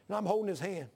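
ordinary talk

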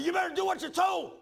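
high, full-force shouted order; retold yelling. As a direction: imitate a brother yelling down the phone. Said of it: "He's yelling at, in the phone".

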